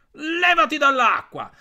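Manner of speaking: high, loud frog yell; shouting Gianni. Acted. Gianni yells at his siblings to leave the water, loud high shout. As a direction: bark him out of the water at full voice. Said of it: "gridò Gianni".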